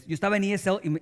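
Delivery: normal talking voice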